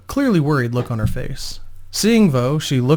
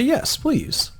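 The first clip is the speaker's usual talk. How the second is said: a polite, much higher "Yes, please"; softer, high, courteous acceptance; 11 semitones higher, 4 dB quieter than usual. A narrator's reading. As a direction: answer sweetly and politely, pitched well up. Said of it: "Vo answered politely".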